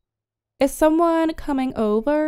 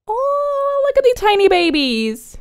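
excited cooing; 8 semitones higher, 3 dB louder than usual. Real, not acted. Player gushing at baby chicks, high and quick. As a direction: squeal it out at tiny babies.

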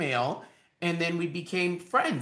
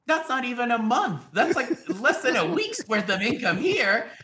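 mock incredulous Michael; 7 semitones higher, 4 dB louder than usual. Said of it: "he must have been like".

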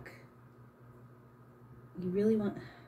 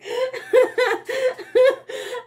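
very high, louder, almost tearful laugh; squealing laugh; 6 dB louder than usual. Real, not acted. Embarrassed at her painting.

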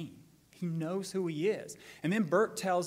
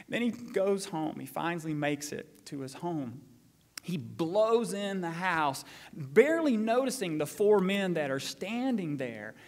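usual speaking voice

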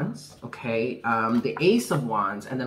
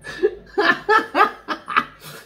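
high falling laugh; 5 dB louder than usual. Real, not acted. Laughs at herself.

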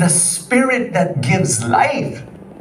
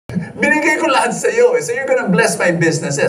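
higher, half-joking voice of his younger self; mock entitled bargaining; 6 semitones higher, faster than usual. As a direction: play his old self demanding a reward from God. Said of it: "I told her".

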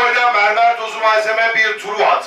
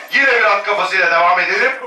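usual speaking voice

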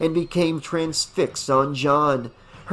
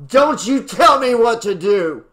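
higher, louder angry outburst; irate; 8 semitones higher, 6 dB louder than usual. A narrator's reading. Irate man lashing out at the woman telling him off, angry shout. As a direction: snap back in a furious raised voice. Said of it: "He was fully irate now".

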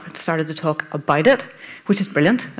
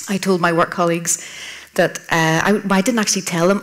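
normal talking voice

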